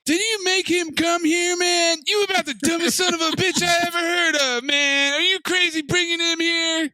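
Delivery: high-pitched, agitated movie-character rant; hammed-up exasperated telling-off; acted angry scolding. Acts out friend's angry scolding.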